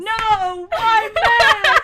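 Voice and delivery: very high, loud protest; shrieked complaint, half in fun; exasperated wail. Real, not acted. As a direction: wail in comic dismay at losing. Protests second wrong guess.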